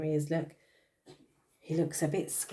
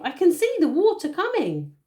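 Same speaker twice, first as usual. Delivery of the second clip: high, louder birdlike voice; cockatoo squawk; 11 semitones higher, 8 dB louder than usual. Acted. Cockatoo warning of the coming water, high squawky voice. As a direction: keep the cockatoo squawking the alarm. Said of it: "squawked the cockatoo".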